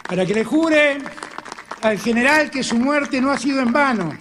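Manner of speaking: loud, high rallying speech; impassioned oratory. Acted. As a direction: swear it to the crowd. Full-force oath to the general.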